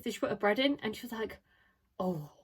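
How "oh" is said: put-on slow, low, hushed common London accent; mum's London accent. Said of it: "a really common accent".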